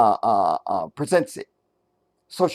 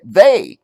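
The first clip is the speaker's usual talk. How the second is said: "They" said much louder and punched hard; stress on they; 4 semitones higher, 9 dB louder than usual. Shown as a demonstration. Host stressing who said it: loud punched "They". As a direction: hammer the word "They". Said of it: "not her".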